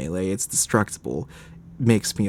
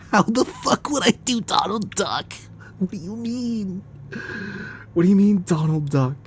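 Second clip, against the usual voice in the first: high-pitched baffled protest; squeaky, indignant disbelief; incredulous; 9 semitones higher than usual. Real, not acted. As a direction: balk at an absurd request. Balks at requested Donald Duck voice.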